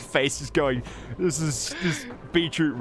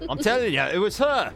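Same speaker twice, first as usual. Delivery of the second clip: higher, insistent orc voice; 8 semitones higher than usual. Acted. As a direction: jab the accusation at her. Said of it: "He's like".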